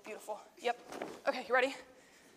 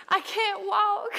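high, loud, drawn-out complaint; begging man; 8 semitones higher, 6 dB louder, much slower than usual. Acted. Teacher voicing the lame man for children, slow loud plea. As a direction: stretch it into a hammed-up wail. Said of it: "our man".

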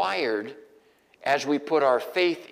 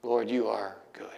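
whispered prayer; 6 semitones lower, 5 dB quieter than usual. Real, not acted. Preacher to God mid-sermon, a whispered prayer. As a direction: lower and softer, a breathy aside to God.

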